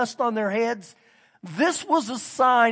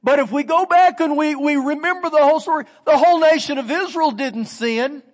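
normal talking voice

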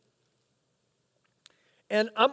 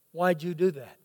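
quiet; 7 semitones lower, 7 dB quieter than usual. Retold or imitated. Son asks killer why.

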